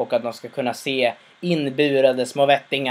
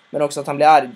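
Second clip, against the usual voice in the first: ordinary delivery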